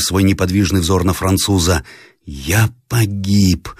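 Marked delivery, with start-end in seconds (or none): none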